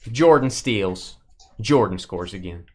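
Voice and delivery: slow, dramatic game call; play-by-play. Pretend. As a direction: narrate the last seconds of a final. Calling a game moment by moment.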